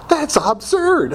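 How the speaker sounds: high, slow mock disbelief; incredulous. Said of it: "I was just like".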